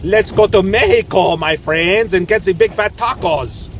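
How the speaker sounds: loud, high hammed-up Spanish-flavoured "Mehico"; mock Mexican accent. Vlogger jokingly invites friends to Mexico, mock accent. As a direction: ham up a fake Mexican accent.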